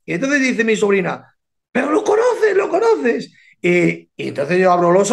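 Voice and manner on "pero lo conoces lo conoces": high, eager disbelief with a laugh; a thrilled niece pressing her uncle; excited niece. Retold or imitated. Niece to uncle on the sofa, high and giggling.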